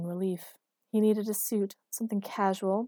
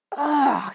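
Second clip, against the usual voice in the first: loud, higher-pitched "ugh"; annoyed groan; 7 semitones higher, 11 dB louder than usual. A narrator's reading. Woman groans from the bedroom at his noise, annoyed. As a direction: groan it out in irritation. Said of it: "An annoyed Erica appeared".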